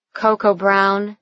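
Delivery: ordinary delivery, nothing marked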